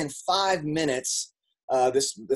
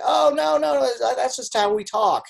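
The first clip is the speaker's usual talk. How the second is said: much higher, louder casual brush-off; friend's breezy reply; 12 semitones higher, 4 dB louder than usual. Retold or imitated. Friend laughing off her family's screaming match, high and light. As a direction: wave it off in a high bright voice. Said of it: "She's like".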